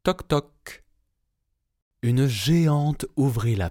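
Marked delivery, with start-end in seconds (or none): 0.0-0.8 high playful knock-knock sound; knocking at the giant's door, high and bright. A narrator's reading. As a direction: tap it out like knuckles on a door.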